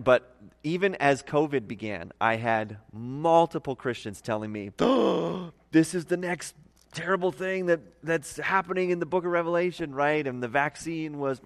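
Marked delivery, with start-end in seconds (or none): 4.8-9.9 mock gasp, then higher-pitched alarm. Telling of Christians reacting as covid began, mock alarm. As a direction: imitate worried believers sounding the alarm.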